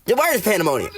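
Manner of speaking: high-pitched announcement of the answer; triumphant reveal. Shown as a demonstration. Revealing the word in the example, high triumphant reveal.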